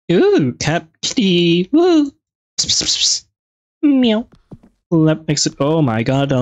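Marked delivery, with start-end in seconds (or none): 0.1-2.1 high, delighted coo at a kitty; excited cooing. Real, not acted. Spotting a cute cat. 2.6-3.3 whispered psst-psst to lure a cat; cat-calling psst. Real, not acted. 3.8-4.3 high, loud playful meow. Pretend. Meowing at game cat.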